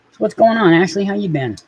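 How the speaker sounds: high squeaky voice for the cat; high-pitched pet talk; cat baby talk. Acted. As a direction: coo at the kitty like a baby. Greeting the cat.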